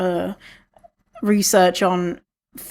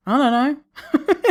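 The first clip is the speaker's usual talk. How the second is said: laughing; 7 semitones higher, much slower than usual. Real, not acted. Laughing admission of not knowing.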